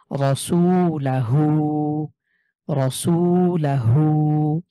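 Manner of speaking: slow রসুলাহু, full-mouthed র; drawn-out রসুলাহু with thick র; heavy r. Shown as a demonstration. Teacher slowly recites রসুলাহু with heavy র. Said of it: "র জবর র".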